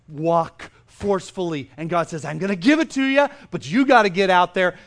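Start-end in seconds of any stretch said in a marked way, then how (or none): 2.2-4.8 emphatic divine charge. Acted. Preacher voicing God urging believers to step out, loud and emphatic. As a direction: push it out like a rousing command.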